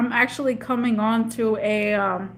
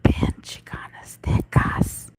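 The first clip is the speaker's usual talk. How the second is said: hushed, mock-secret whisper; playful whisper; 4 semitones higher, slower than usual. Streamer whispering to a long-missed viewer. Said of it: "whispered greeting".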